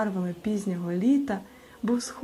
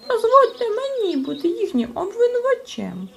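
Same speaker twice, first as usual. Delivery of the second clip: much higher, louder squeaky insisting voice; insistent; 6 dB louder than usual. A narrator's reading. Chmykh insists on prosecuting, high squeaky pushy voice. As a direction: push to be prosecutor, high and eager. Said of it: "наполягав Чмих".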